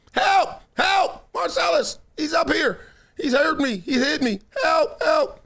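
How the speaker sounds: high-pitched mock scream for help; mock lady screaming. Imitating the neighbor lady screaming for help out the window. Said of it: "a lady screaming".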